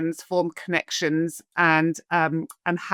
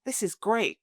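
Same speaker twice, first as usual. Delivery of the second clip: soft, contented relief; blissful sigh; 3 semitones higher, 5 dB quieter than usual. Retold or imitated. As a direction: sigh it out like finding home. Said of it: "I remember thinking".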